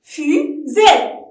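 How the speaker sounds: "fusée" as two loud beats; loud teaching voice, gap inside "fusée"; syllable by syllable. Shown as a demonstration. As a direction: split "fusée" firmly into fu and sée. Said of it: "frappez-le en syllabe".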